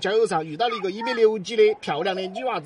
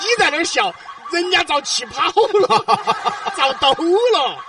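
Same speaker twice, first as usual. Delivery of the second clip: words broken by laughter, pitch raised; laughing while talking; 10 semitones higher, 6 dB louder than usual. Real, not acted. Host laughing after failed prank.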